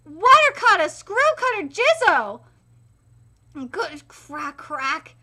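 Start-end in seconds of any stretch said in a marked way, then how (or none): none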